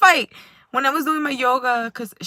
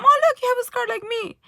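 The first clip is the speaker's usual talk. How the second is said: much higher, bright coaxing; cheerful; 12 semitones higher, 4 dB louder than usual. Retold or imitated. Telling the boy with a scar that she has one too, cheerfully. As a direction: lift him up, playful and eager.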